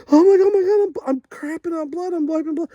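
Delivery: very high, squealing panicked wail; freaking out. Retold or imitated. Man staring into the toilet, wailing in panic. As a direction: burst out loud and shrieky, then keep wailing high. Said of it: "So I'm freaking out".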